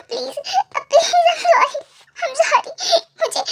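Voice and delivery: husband weeping to his wife; crying apology. Acted. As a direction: beg forgiveness while breaking down. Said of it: "[name] देखो पकड़ के रोता है".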